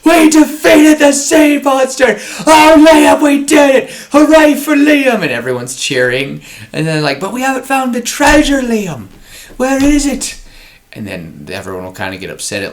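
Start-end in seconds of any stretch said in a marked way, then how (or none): 0.0-5.2 put-on very high, loud cheering child voice; excited kid cheer. Liam cheering his crew after beating the sea monster, high excited kid voice. 7.2-10.4 high, loud anxious cartoon voice; worried crew voice. Crew questioning Liam about the missing treasure, high worried cry.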